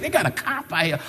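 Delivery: soft, laughing murmur; amused by a cop at the grocery store, laughing; laughing aside. Retold or imitated. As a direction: chuckle it out under breath.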